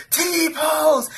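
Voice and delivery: high-pitched imitated battle cry. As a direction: call out your special move. Retold battle cry in match.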